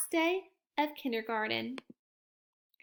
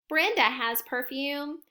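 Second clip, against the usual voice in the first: ordinary delivery, nothing marked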